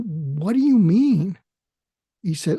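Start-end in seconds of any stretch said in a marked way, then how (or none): 0.0-1.4 slow, higher-pitched puzzlement; baffled question. Retold or imitated. Asking the person who envies him, slowly baffled.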